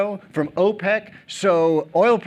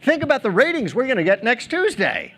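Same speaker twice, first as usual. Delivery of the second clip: much higher, eager TV producer voice; 9 semitones higher than usual. Retold or imitated. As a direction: cut in high and thrilled about the ratings. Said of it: "steps forward and says".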